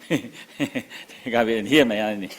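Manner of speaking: mock client plea, laughing through it. Client handing over garbage and money to therapist, laughing. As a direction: chuckle while quoting the client again.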